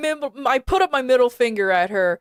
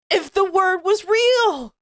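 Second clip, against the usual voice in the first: exasperated outburst; 6 semitones higher than usual. Real, not acted. Outburst of disbelief.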